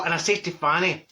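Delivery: ordinary delivery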